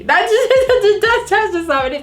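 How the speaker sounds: high-pitched, laughing through the words; real giggly laugh while talking. Real, not acted. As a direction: crack up at your own muddle. Laughs at own muddled idiom.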